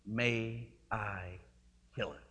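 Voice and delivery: a low, soft, calm offer; quiet gentle question. Retold or imitated. Angel quietly asks the tormented man, soft and low. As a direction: ask it softly and slowly, no pressure.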